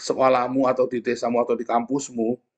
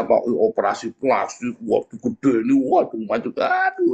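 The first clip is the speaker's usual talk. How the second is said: mock dismay. Mocking plastic surgery result.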